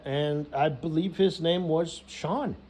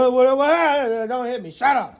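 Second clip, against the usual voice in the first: high, loud panicked yelps, then a shout; mock bullying scuffle; 7 semitones higher, 7 dB louder than usual. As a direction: flail and yelp, then yell it down. Acts out bullying the computer fixer.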